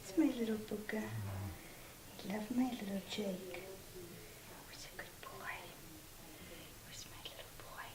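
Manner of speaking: soft whispered cooing to a pet. Real, not acted. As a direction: whisper sweet nothings to the dog. Whispered baby talk to dog.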